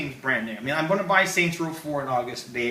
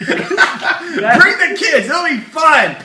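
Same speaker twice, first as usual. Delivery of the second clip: mock hype; 10 semitones higher, 11 dB louder, much slower than usual. Mock cheerful ad for show.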